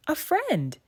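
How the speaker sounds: light up at the thought of a friend; joyful thought. A narrator's reading. Mermaid spotting the girl on the beach, joyful. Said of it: "Melody thought with joy".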